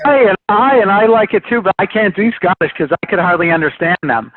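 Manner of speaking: high, loud opening in a mock brogue; attempted Scottish accent. Shown as a demonstration. Guest who can't do Scottish, attempted brogue accent. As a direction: have a laughing go at Scottish, half Irish. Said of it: "Irish, Irish I can do".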